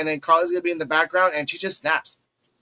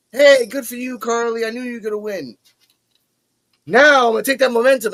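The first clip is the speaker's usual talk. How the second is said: higher, louder mock wrestler boast; imitating Kendall; 7 semitones higher, 6 dB louder than usual. Retelling the promo to viewers, a higher, louder mock Kendall. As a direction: ham up the confident babyface. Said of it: "Kendall's like".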